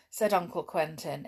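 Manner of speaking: plain reading voice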